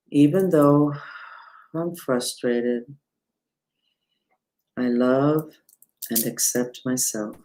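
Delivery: slow, soft mantra chant; mantra recitation. Shown as a demonstration. Teacher leading viewers through the mantra, slow soft chant. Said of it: "I'm gonna say it three times out loud".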